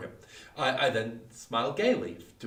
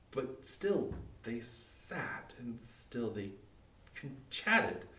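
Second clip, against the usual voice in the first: very slow and much softer, drawn out; slow, dread-filled hush; 3 semitones lower, 8 dB quieter, much slower than usual. Acted. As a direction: let the dread creep in word by word. Dread as the ringing grows.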